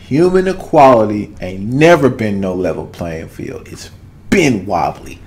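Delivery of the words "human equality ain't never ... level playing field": ordinary talk, nothing marked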